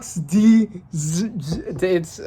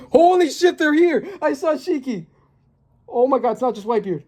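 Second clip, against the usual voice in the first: shocked excitement; 8 semitones higher than usual. Real, not acted. Fan spots Shiki in the chapter, shocked and excited. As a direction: blurt it out in real shock. Spots famous characters in manga.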